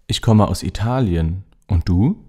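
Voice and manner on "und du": ordinary talk, nothing marked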